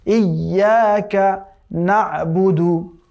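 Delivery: ইয়াকা না'বুদু very slow, with a clear ain; ইয়াকা না'বুদু recited slowly; correct tajweed. Shown as a demonstration. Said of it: "নুন আইন জবর না".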